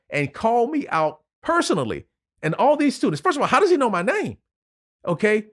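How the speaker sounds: real emotion breaking through the retelling; choked up. Real, not acted. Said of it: "hard for me to tell that story without crying".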